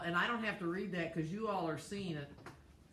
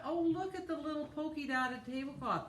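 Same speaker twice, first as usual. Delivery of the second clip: surprised; 9 semitones higher than usual. Real, not acted. Noticing new tablecloth.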